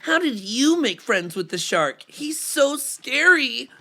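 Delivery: amazed frightened fish. Acted. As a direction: gasp it out in awe. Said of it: "I'll read responses as voices".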